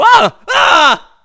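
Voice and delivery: shrieking high and very loud in fright; panicked scream. Retold or imitated. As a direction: yelp and scream like something just bit you. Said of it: "I go".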